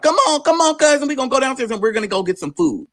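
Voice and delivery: high-pitched coaxing, imitating a cousin; retold excited "come on, let's go"; cousin's eager urging. Cousin urging her downstairs, retold.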